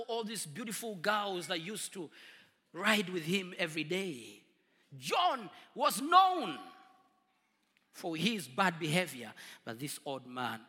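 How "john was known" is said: normal talking voice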